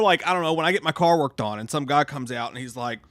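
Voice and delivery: ordinary talk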